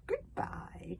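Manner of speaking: high, breathy whisper of goodbye. Acted. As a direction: whisper it with mock sweetness. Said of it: "whispered Alvin the treacherous".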